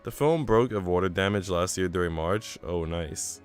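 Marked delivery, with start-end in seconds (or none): none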